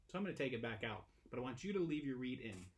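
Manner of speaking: ordinary talk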